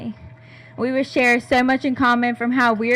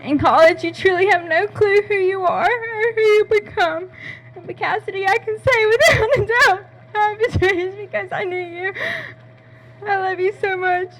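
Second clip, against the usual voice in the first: fighting back sobs while speaking; tearful, crying; 8 semitones higher than usual. Real, not acted. Bridesmaid's toast to her best friend, crying through it. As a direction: voice breaking with tears, higher. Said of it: "I'm gonna try not to cry".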